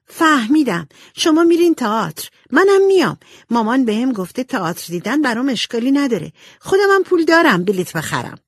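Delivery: high, loud, eager little-sister voice; excited. A narrator's reading. Little sister inviting herself to the theatre, excited. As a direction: burst out with excitement, voice shooting up. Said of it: "با هیجان گفت".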